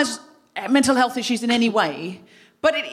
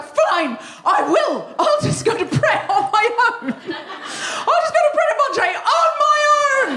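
huffy screaming; 14 semitones higher than usual. Retold or imitated. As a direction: storm off in a hangry huff. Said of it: "having screamed at Tom".

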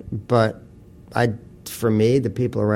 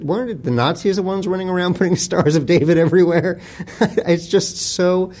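laughing; 8 semitones higher than usual. Real, not acted. Laughing at the absurd tile idea.

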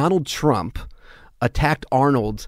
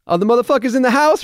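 much higher, a bit louder party call; hammed-up star arriving; boisterous entrance; 11 semitones higher, 4 dB louder than usual. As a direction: announce yourself to the room. Star announcing his arrival.

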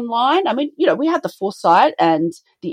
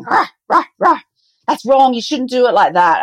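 higher, hammed-up "rah rah" rant; mock ranting; 4 semitones higher, 3 dB louder than usual. Her younger self firing back at an email, mock ranting. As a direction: imitate her old knee-jerk blow-up. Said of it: "I would have just been".

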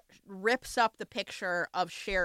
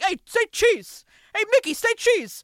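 mocking bully voice; 13 semitones higher, 7 dB louder than usual. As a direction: tease like a schoolyard bully. Bullies taunting with camera.

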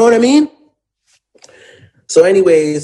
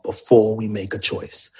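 hushed; 6 semitones lower, 7 dB quieter than usual. Retold or imitated. Partner calmly urging to wait.

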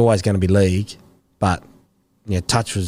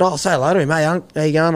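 bright, pitched-up hello across the field; high eager greeting; 7 semitones higher, faster than usual. Retold or imitated. Showing off to father-in-law, calling to Cliffy, high and eager. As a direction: call out keen and high, showing off a bit. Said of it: "Like that".

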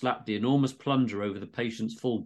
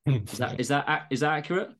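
ordinary talk

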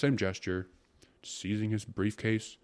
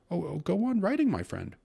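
ordinary delivery, nothing marked

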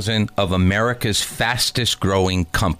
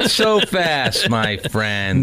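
drawn-out catchphrase; slower than usual. Pretend. Catchphrase repeated slowly.

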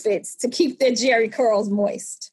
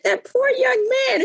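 a hint of the mother, pitched higher; imitating mother; 12 semitones higher than usual. Mother pitying the boy, lightly voiced, higher. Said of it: "She said".

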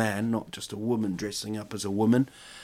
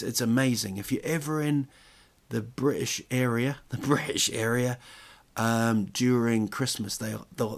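ordinary talk, nothing marked

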